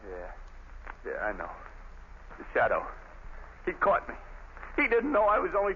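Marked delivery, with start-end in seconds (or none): 0.1-1.5 breathy, barely voiced, much softer; the fight drained out of him; low defeated near-whisper; hopeless murmur. Condemned man realizing he's doomed.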